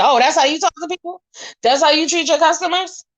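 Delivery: high-pitched mock-offended questioning; indignant retort. Guest confronting hotel staff over rudeness, indignant. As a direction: challenge them in a high, affronted voice.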